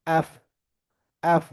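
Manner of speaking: এফ with an open "a" vowel, "aef"; corrected English F. Shown as a demonstration. Teacher drills the class on F, corrected pronunciation. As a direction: model এফ slowly apart with a wide vowel. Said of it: "কি বলতে হবে".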